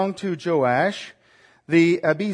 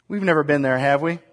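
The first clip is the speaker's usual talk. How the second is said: normal talking voice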